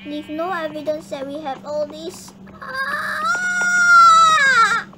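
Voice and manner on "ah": long, loud, high-pitched scream. Pretend. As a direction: scream out one sustained "ah". Sudden scream during game.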